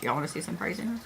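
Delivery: very soft, secretive repeat; hushed teasing. Real, not acted. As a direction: drop it right down as a hushed tease. Teasing repeat, dropped very low.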